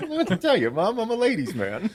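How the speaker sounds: high-pitched mock protest to mom; cheeky comeback. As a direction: act out a son playfully defending himself. Acted cheeky reply to mom.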